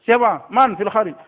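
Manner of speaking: loud, high call through a door; acted mother calling who's there. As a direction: call out to whoever is knocking. Mother calls to door knocker.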